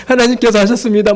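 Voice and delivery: high-pitched acted praise; gushing testimony. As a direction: imitate someone testifying eagerly. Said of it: "막 여기저기 간증하고 다녀요".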